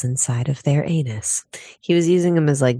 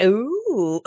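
excited ooh; 6 semitones higher than usual. Retold or imitated. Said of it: "I'm like".

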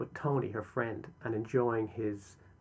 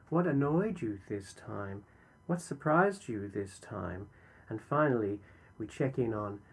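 usual speaking voice

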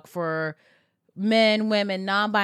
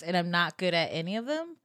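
ordinary delivery

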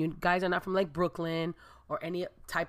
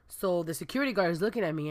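ordinary talk, nothing marked

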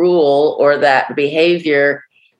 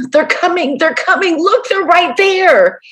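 high, urgent panicked voice; terrified warning; 13 semitones higher than usual. Retold or imitated. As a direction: warn her they're right behind him. Terrified man warning about pursuers.

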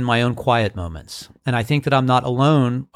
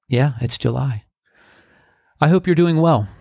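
usual speaking voice

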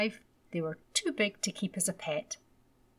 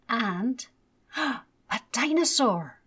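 delighted gasp; 4 semitones higher, 4 dB louder, much slower than usual. A narrator's reading. Child sees dinosaur toy.

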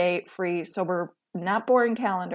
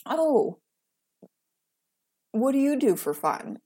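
higher, mock-curious prying question; lightly voiced prying acquaintance; mocking nosy asker; 5 semitones higher than usual. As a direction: parody the person asking, pitched up.

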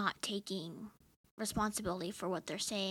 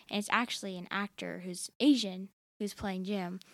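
ordinary talk, nothing marked

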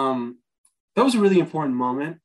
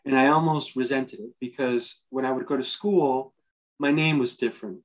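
ordinary talk, nothing marked

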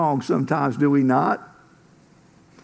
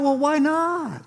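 puzzled why not; 9 semitones higher, slower than usual. Retold or imitated. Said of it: "And they're like".

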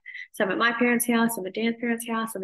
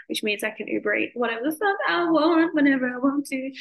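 gleeful; 6 semitones higher, 3 dB louder than usual. Real, not acted. Gleeful about Uber Eats nearby.

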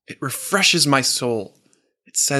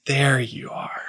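hushed, near-whispered recognition; tender recognition; 3 dB quieter than usual. Acted. Coach finding the real you, tender hush. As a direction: breathe it gently, as if you found them.

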